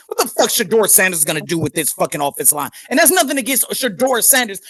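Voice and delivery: ordinary talk, nothing marked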